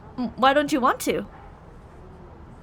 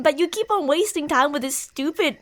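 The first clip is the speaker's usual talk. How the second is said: usual speaking voice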